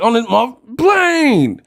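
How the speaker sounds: high, exasperated movie-hero outburst; Samuel Jackson. Retold or imitated. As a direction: imitate a fed-up action hero. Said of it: "Bro, he was like".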